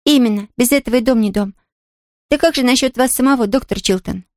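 put-on a lively little girl. A narrator's reading. Pollyanna voiced by reader.

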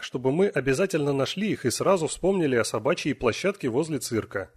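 plain reading voice

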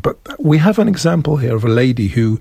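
usual speaking voice

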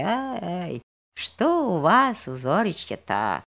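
mechanical, slow and quiet repeat; absent-minded. Acted. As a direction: say it without thinking, drifting. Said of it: "повторял он как-то машинально".

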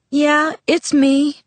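a slow, wavering reply; unsteady. Acted. Bella, just home from the forest, answering her father unsteadily. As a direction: let the voice wobble. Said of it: "My voice was unsteady".

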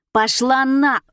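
loud, high, angry retort; snapping back. A narrator's reading. Brazen Alisa curses back at Iosifova, snapping. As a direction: snap back with a shouted brush-off. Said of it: "огрызнулась отвязная Алиса".

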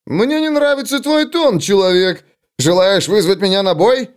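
high, indignant challenge; offended. Acted. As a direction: bristle and demand a fight. Dwarf takes offence. (обиженный вызов гнома)

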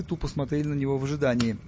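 ordinary delivery

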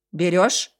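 loud gruff devil voice. A narrator's reading. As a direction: bark it like a big devil. Devil asks buyer.

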